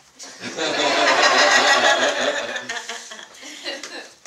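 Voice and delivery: long, loud burst of laughter. Real, not acted. Laughing after a student's thanks.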